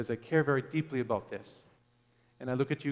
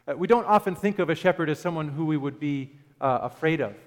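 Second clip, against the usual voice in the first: ordinary delivery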